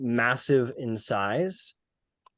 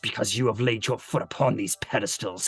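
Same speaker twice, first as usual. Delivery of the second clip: menacing demon. Acted. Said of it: "and goes".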